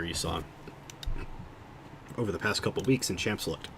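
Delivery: talking through hiccups; words broken by hiccups; hiccuping. Real, not acted. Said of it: "I'm hiccuping so much".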